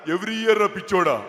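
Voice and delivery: ordinary delivery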